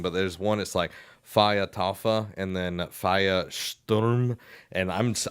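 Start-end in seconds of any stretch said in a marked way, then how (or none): 1.2-2.3 slow, careful German "Feiertaufe"; tentative learner's German on "Feiertaufe"; German pronunciation. Shown as a demonstration. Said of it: "you have to say German words". 2.8-4.4 slow, careful German "Feiersturm" with a "sht" sound; tentative learner's German on "Feiersturm"; German pronunciation. Shown as a demonstration. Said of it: "probably not still saying it right".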